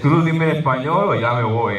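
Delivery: put-on dad's voice with a Spanish accent; Spanish-speaking father telling his son to talk in Spanish or he leaves. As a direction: give the ultimatum in Spanish, Mexican-dad style.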